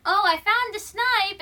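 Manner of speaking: acted-out excited boy; excited retold exclamation. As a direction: announce your discovery with lifted pitch. Boy reports exciting find.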